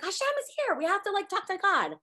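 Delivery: a lighter flustered hurry. Retold or imitated. Miriam and Aaron scrambling to talk to God, mock fluster. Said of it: "And they're all flustered".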